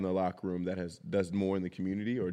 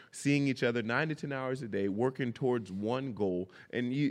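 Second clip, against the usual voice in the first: normal talking voice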